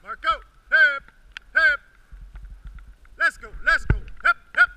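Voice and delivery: high, loud urging calls; dog sled musher. Real, not acted. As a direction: drive the team on.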